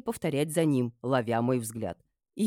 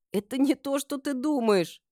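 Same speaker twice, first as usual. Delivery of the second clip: plaintive moan; 11 semitones higher, 3 dB louder than usual. A narrator's reading. Embarrassed mistress explains herself to friend, moaning. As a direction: moan it pleadingly. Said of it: "жалобно простонала я".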